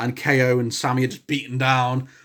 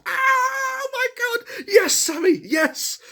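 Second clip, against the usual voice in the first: very high, drawn-out shout of joy; ecstatic cheer; much slower than usual. Real, not acted. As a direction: scream with delight like a fan. Cheering the chair shot.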